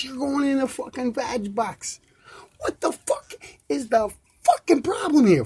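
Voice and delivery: high, heated swearing tirade; angry rant. Real, not acted. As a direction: vent hard at the folks listening. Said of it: "that's my twenty-five minute fucking rant".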